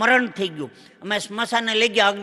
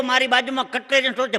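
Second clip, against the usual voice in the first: usual speaking voice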